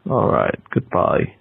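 ordinary delivery

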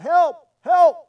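high, loud shout for rescue; crying out for help. Acted. Man hanging off a cliff yells for help, crying out. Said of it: "he began to cry out".

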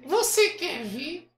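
high, very slow puppet voice; Kleber. Acted. Puppet offering the host a demonstration, high puppet voice. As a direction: stretch the offer out as a squeaky dummy. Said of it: "acha uma voz que pareça com o boneco".

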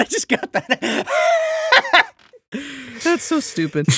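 high, laughing through the words; helpless giggles while talking; cracking up. Real, not acted. Friend finally getting the pun, cracking up.

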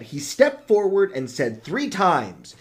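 usual speaking voice

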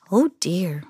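soft, sighing disappointment; sad. A narrator's reading. Old woman refused by Camilla, sad. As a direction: let it sink with mild sadness. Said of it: "the old woman said sadly".

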